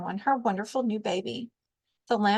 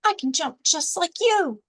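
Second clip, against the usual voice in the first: excited boast; 6 semitones higher, 3 dB louder than usual. A narrator's reading. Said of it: "he shouted".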